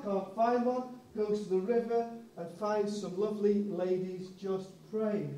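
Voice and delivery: ordinary talk, nothing marked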